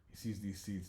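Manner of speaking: plain reading voice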